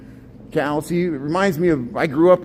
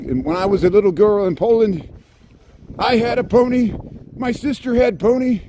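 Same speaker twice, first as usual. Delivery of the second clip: higher, louder mock Polish woman; Seinfeld girlfriend from Poland; 8 semitones higher, 4 dB louder than usual. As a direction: quote her like the Seinfeld bit, a bit hammed-up. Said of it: "she was like".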